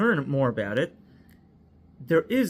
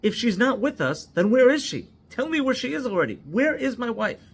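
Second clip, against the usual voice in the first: high, loud, panicked demand; retold frantic yelling; 9 semitones higher, 4 dB louder than usual. Husband demanding to know where his wife is, frantic yell. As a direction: yell for your missing wife. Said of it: "yelling and saying".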